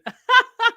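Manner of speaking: sudden high burst of laughter; loud high laugh. Real, not acted.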